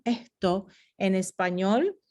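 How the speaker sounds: ordinary delivery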